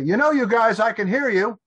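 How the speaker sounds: high, loud shout down to them; calling out. Acted. Kid upstairs calling down to bickering parents, loud shout. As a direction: raise the voice sharply so they hear.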